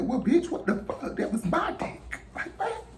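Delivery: high shocked outburst; rising, incredulous shock; outraged disbelief. Retold or imitated. Finding her friend talking to her man, shocked and high. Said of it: "So I'm like".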